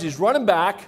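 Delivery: ordinary talk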